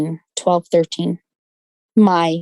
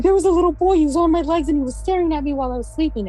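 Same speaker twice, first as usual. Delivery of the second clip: put-on frightened girl; 10 semitones higher, faster than usual. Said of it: "And she was like".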